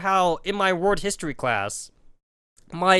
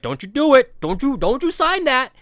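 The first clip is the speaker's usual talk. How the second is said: mock warning; 7 semitones higher, 4 dB louder than usual. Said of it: "I'm like".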